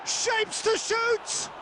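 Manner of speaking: high, slow, stretched call; excited shot call. Acted. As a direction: draw out the call as he shoots. Commentator calls a shot.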